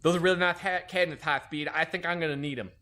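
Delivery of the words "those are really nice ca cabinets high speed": imitating the chief's bad lisp; mock a heavily lisping boss; really bad lisp. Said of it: "he talked with a really bad lisp".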